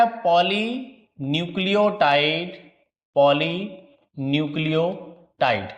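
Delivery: drawn out word part by part with gaps; slow dictation. Real, not acted. Teacher writing a definition for students, slow dictation. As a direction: dictate very slowly as if writing along.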